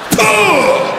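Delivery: high, open blast of "fuh"; robot-like mock sneeze. Stranger sneezing like a robot, high mock sneeze. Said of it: "like, the robot from eighty-five".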